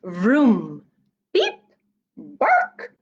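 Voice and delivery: vroom beep then a high bark; truck puppy noises. Acted. Reader voicing the puppy truck's vroom, beep, bark.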